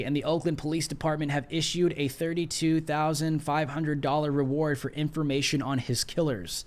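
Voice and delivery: ordinary delivery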